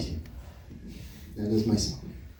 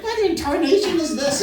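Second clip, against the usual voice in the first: high-pitched outraged mom; mock motherly outrage in a high voice; mom impression; 8 semitones higher than usual. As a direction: imitate a scandalized mother, pitched up. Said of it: "my mom would be like".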